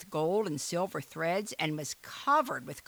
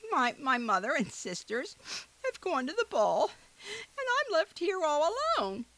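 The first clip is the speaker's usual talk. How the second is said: put-on high, tearful young girl; 10 semitones higher than usual. A narrator's reading. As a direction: sob it out like a lonely girl. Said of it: "sobbed poor Cinderella".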